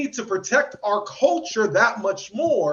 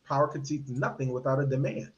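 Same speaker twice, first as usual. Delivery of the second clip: "power concedes nothing without a demand" lower and softer; subdued, lower "power concedes nothing without a demand"; low quiet quote; 7 semitones lower, 7 dB quieter than usual. Shown as a demonstration. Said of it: "as Frederick Douglass taught us".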